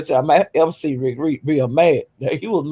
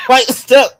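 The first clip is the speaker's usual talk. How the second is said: laughing, mock tone; 7 semitones higher, 5 dB louder than usual. Laughing repeat of slur.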